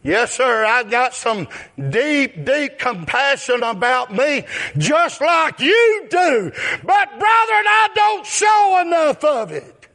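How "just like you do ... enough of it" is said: preaching at full force. Acted. Preacher confessing his own compassion to the flock, shouting.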